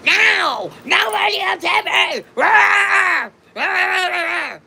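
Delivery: high, loud yelling impression; shrieked denial dissolving into roaring nonsense; mock furious tantrum. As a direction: scream it back like someone losing it. Impersonates the angry woman's outburst.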